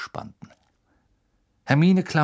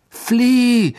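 loud high cry; shouting; 13 semitones higher, 9 dB louder than usual. A narrator's reading. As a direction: yell it together. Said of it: "riefen Harry und Hermine aus einem Mund".

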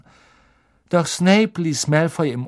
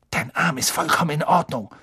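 hissed retort, a bit higher; angry hiss; 4 semitones higher, faster than usual. A narrator's reading. Ron hisses at Malfoy about the faked injury, angry hiss. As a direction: hiss it at your rival. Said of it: "zischte er Malfoy zu".

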